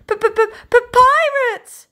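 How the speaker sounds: a very high, loud, panicked stuttering shout; stuttering alarm shout. Acted. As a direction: scream the warning down from the crow's nest. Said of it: "shouted Ten Pint Ted from the crow's nest".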